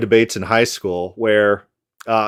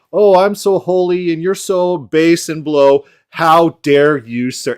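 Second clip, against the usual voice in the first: higher, slow, hammed-up self-righteous scolding; mock pompous outrage; 5 semitones higher than usual. Mocking the lawyer's rhetorical flourish, pompous outrage. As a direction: sneer like a preening moralist. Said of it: "this rhetorical flourish is like".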